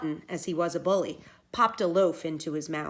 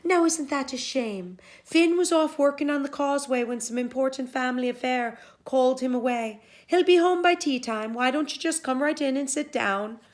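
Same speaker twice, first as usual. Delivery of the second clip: higher, honeyed hostess voice; sweet-talking Una; 6 semitones higher, 5 dB louder than usual. Acted. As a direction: charm the giant while lying. Una sweetly deceiving giant.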